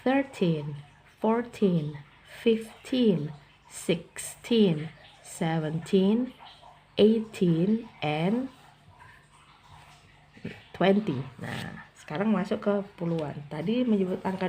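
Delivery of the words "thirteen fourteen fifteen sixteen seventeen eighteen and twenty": put-on thirteen to eighteen with teen drawn long and stressed. Shown as a demonstration. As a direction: lean on the teen ending. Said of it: "penekanan di bagian belakangnya".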